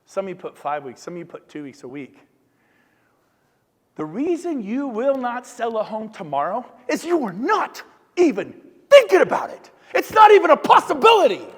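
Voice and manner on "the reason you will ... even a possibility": rising from plain to a loud, high shout; building from plain talk to a loud shout at the room. Real, not acted. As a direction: climb until you're shouting. Building a point to the crowd.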